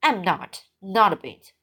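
usual speaking voice

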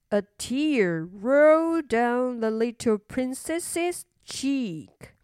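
ordinary delivery, nothing marked

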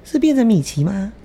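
high squeaky cartoon mouse; Mickey Mouse voice. Asks if voice became Mickey.

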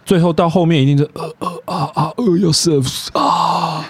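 hammed-up breathy moans; mock moaning. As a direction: fake sex moans, half joking. Mock moaning during sex.

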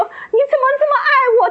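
激动得带着哭腔，声音高而快; 像重新体验那一刻，哽咽地问上帝; 哭着说. Retold or imitated. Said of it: "我就一直哭". (带着哭腔高声感动地问)

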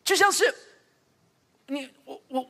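ordinary delivery

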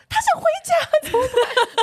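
high, slow panicked cry. Retold or imitated. Editor panicking to her colleagues after the call, high panicked wail.

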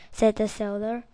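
usual speaking voice

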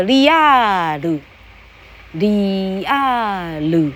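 利亚鲁 said slowly in three syllables, ri-a-ru; 利亚鲁 with a slow Japanese accent; Japanese-style English. Shown as a demonstration. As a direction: stretch real into 利亚鲁 the Japanese way. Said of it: "他念作".

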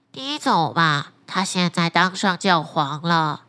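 a low, unhurried talking fish; flounder voice. Acted. Flounder speaks to fisherman.

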